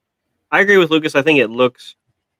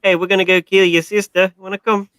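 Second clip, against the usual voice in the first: put-on Chucky line in a New Zealand accent; playful New Zealand accent, pitched up; 9 semitones higher, 4 dB louder than usual. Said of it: "imagine if Chucky had a New Zealand accent".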